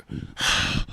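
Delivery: heavy, wordless huff of annoyance; two long mock sighs; imitated exasperated sigh. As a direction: sigh pointedly, mimicking an annoyed sleeper. Imitates friend's annoyance at light.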